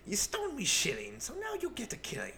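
high, rhyming leprechaun impression; squeaky mischievous leprechaun, rising to a squeal. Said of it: "He made more rhyming couplets than Doctor Seuss".

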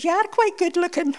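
high-pitched Glaswegian impression; cheeky punchline in broad Glaswegian; Glasgow accent. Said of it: "I'll do the Glasgow accent".

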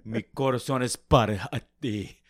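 melodramatic Spanish lover; hammed-up telenovela declaration of love; Antonio Banderas. Podcaster playing a telenovela lover, impassioned Spanish impression. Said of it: "Or, like, Antonio Banderas".

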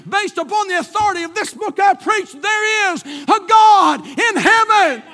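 high, driving repetition building to a shout; full-force preaching. Real, not acted.

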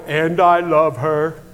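slow, stretched-out own line; drawn-out retold declaration. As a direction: draw each word out for her to copy. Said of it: "And I go".

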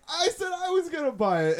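high, loud indignant whine; high loud mock indignation; mock exasperation. Outraged at brother's purchase.

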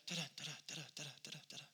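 soft quick da-da heartbeat sounds; imitated fast heartbeat. Hearing the baby's heartbeat at the doctor's, soft imitated thump. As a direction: tap out a racing heartbeat with your voice. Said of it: "all of a sudden we hear that".

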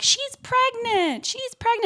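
very high mock cry, drawn out; squeaky-high retold exclamation; high-pitched mimicry. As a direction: imitate someone crying out way up high. Mimicking shouts from the clip.

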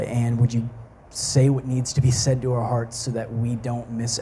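low, soft, unhurried praying voice; earnest prayer. Real, not acted. Preacher asking Jesus to speak through him, hushed prayer. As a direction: pray quietly, pausing between requests.

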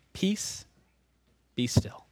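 soft, quiet command to the sea; calm the storm with a soft, firm voice; hushed command. Acted.

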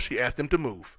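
ordinary talk

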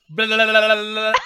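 loud, high babble imitating a child; mock-German gibberish. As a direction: babble nonsense like a kid faking German. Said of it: "it's just gibberish".

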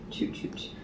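breathy whispered toot. Acted. Toot call, high then whispered.

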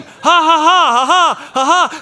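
loud mock ha ha ha; mocking laughter. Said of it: "pointing at me and laughing".

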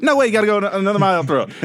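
high, worked-up comic arguing; squawked protest at the other navigator; Kevin Hart. Acted. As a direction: argue back in an excited high pitch. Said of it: "The Rock and Kevin Hart just arguing".

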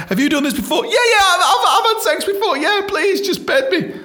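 high, laughing mock virgin bluff; nervous virgin. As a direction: ham up a nervous first-timer faking experience. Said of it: "like when you're losing your virginity".

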